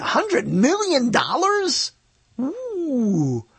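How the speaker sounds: amazed. Real, not acted. Amazed at film budget.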